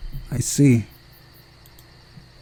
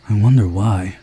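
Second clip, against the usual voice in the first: ordinary delivery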